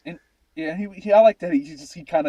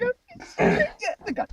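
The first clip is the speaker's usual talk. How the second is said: mock relief. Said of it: "You're like".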